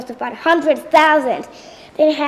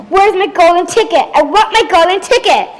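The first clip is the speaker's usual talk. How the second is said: loud, higher demanding yell; retold screaming; 3 semitones higher, 5 dB louder than usual. Veruca re-enacts screaming at her father for a ticket, loud yell. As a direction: re-enact a tantrum, louder and higher. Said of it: "I was screaming at".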